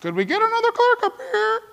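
high, exasperated call for another clerk; high mock complaint in the checkout line; impatient shopper. Shopper stuck in a long line whines in a high voice. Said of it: "you're like".

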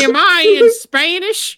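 high, drawn-out silly question; mock bewildered quote. Repeating a remembered line for laughs, high mock bewilderment. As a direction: stretch it out in a high comic voice.

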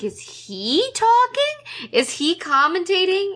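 high, drawn-out incredulous question; exaggerated, slow bewilderment; baffled disbelief. Retold baffled reaction to scene.

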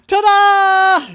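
high, loud drawn-out fanfare; triumphant ta-da. A narrator's reading. Little gymnast announces herself to the family, triumphant. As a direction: belt it out like a little show-off. Child showing off on leaving.

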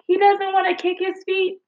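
high, puzzled imitation of the swim instructor; mock instructor. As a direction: lightly mimic the teacher's surprised question. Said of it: "she's like".

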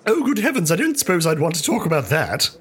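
high, prim, startled lady voice; flustered lady. Acted. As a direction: gasp it out like a scandalised lady.

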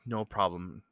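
soft, low, under the breath; mouthed back. Retold or imitated. Man answering a mom's thanks, mouthed softly. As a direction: imitate a discreetly mouthed reply. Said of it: "I mouthed it back to her".

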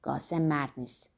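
low, quiet grumble; under the breath. A narrator's reading. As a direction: slip it in under your breath. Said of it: "زیر لبی گفت".

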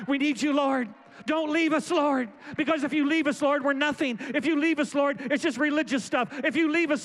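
crying-out prayer. Acted. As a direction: cry out to God as if He might leave. Pastor cries out in prayer.

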